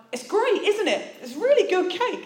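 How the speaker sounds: high, bright awkward chirp; turning to a stranger and joking awkwardly about the cake; awkward joke. Retold or imitated. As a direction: cover the moment with a squeaky nervous joke. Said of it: "just about to make some sort of awkward joke".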